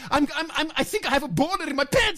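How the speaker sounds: put-on high, fast parody of a European accent; over-excited fake European accent, tumbling along; mock European accent. Host parodies the speaker getting carried away, excitable mock European accent. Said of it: "Your accent is great".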